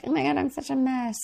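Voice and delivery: high-pitched imitated apology; mock-flustered and self-deprecating; mock self-reproach. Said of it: "that's why we apologize".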